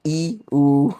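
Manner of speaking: loud careful "I, U"; pronunciation demo. Shown as a demonstration. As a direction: model "I, U" as Brazilian letters. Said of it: "seria como se fosse I".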